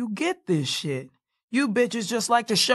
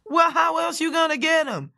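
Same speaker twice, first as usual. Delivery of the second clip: loud; 10 semitones higher, 3 dB louder than usual. Acted. Defending cockroach catching, loud.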